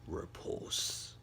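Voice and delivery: low whispered single word; whispered command. Acted. Izuku casting his quirk, low whisper. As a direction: breathe the word out like a spell.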